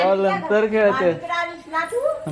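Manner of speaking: put-on very slow high baby voice; speaking for baby. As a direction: say it as the little girl would. (लहान मुलीच्या आवाजात हळू बोलणे)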